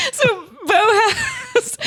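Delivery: high, breathless laughing; dissolving into laughter; laughing hard. Real, not acted. Co-host cracking up at the parody, laughing hard.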